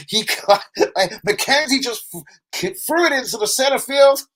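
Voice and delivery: high mock American commentary, laughing; hammed-up American play-by-play. Streamer doing mock American play-by-play, laughing through it. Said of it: "that's an American".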